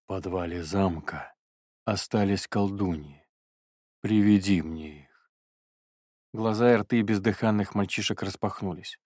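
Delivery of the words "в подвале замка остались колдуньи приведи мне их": distorted bass. Acted. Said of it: "молвил портал искажённым басом".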